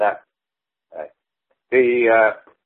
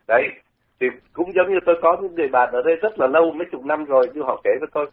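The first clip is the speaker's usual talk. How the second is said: normal talking voice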